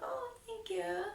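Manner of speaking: high, shy little "thank you"; coy thanks. Retold or imitated. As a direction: play it bashful and flattered. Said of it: "I did exactly how I would do".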